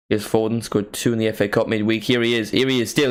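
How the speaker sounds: ordinary delivery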